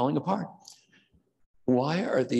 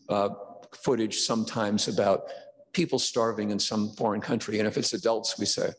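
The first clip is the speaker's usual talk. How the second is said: ordinary delivery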